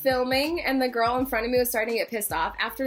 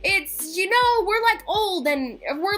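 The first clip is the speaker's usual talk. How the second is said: high, emphatic rant; exasperated; 7 semitones higher, 3 dB louder than usual. Real, not acted. Venting at people who swat cameras, exasperated. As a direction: plead with people, louder and higher.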